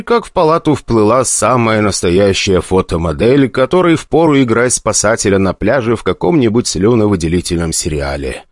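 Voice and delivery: usual speaking voice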